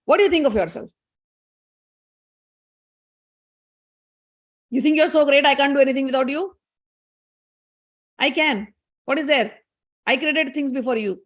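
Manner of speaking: acted indignant comeback, voice pushed up; angry retort. Husband in full mode of passion, angry. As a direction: throw it back at the wife, affronted. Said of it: "Brahmaji in full mode of passion, angry".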